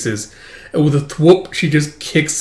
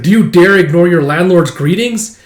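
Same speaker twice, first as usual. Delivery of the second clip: louder, haughty challenge; a hammed-up haughty challenge; mock challenge; 4 semitones higher, 6 dB louder than usual. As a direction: call her out like a snob. Voicing the swordsman's challenge.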